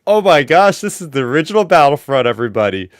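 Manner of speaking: replayed fan excitement, jumping up in pitch and volume; retold excitement. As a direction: exclaim it like a big reveal, settling by the end. Replays own thrilled discovery of game.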